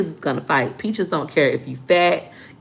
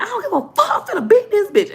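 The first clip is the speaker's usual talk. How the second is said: high furious sister; 3 dB louder than usual. Retold or imitated.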